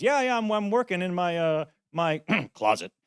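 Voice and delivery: high, hesitant put-on voice; sheepish mock confession. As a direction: stall and clear your throat before admitting it.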